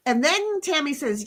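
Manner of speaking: ordinary delivery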